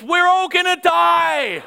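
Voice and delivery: high, loud, drawn-out panicked scream; kid's scream. Retold or imitated. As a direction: scream it slowly like a panicking kid. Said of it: "some kid up the back screams".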